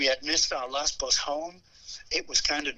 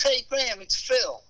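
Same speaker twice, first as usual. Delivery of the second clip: Phil on phone; 9 semitones higher than usual. Retold or imitated. [name] voiced again.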